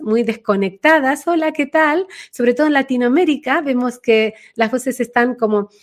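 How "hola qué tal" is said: high, disconnected greeting; squeaky-light caricature of a high voice; high little voice. Shown as a demonstration. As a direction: pitch it up, thin and cut off from the body. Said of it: "vocecitas justamente muy altas, muy agudas".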